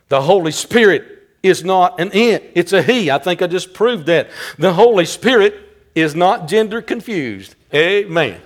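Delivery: ordinary talk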